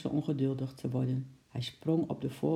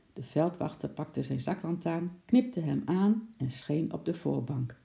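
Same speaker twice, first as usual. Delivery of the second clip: ordinary delivery, nothing marked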